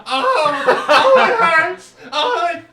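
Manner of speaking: high, loud, laughing whimper; GM's burnt portrait wailing; painting man in pain. Acted. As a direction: howl in hammed-up pain. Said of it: "he goes".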